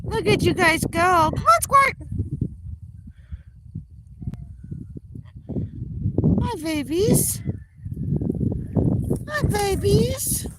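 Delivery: high, slow, cooing praise. Real, not acted. Owner encouraging geese into water: high cooing praise.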